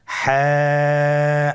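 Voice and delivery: হ্যাঁ held very long with a throaty, squeezed h; drawn-out letter name. Shown as a demonstration. Teacher leads students in repeating the letter, slowly drawn out. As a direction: hold হ্যাঁ long, pressed from mid-throat. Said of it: "আবার বলুন".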